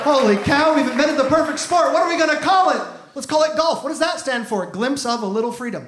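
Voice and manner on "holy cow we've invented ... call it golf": mock thrilled inventor.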